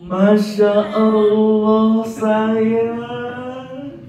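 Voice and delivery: put-on high, slow and tender, gushing over the child; doting parent. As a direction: coo lovingly at a child who made a mess. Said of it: "ekspresinya seperti ini". (orang tua memuji anak dengan lembut dan bernada tinggi)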